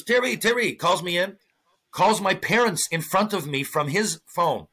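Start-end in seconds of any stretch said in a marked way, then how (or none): 0.0-0.7 higher, calling a name twice; lightly voiced coach calling Terry. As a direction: summon the player cheerfully. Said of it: "He goes".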